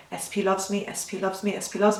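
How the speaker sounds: soft, fast, monotone loop; robotic repetition. Shown as a demonstration. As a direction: mutter it on repeat while busy. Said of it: "So you'd be going".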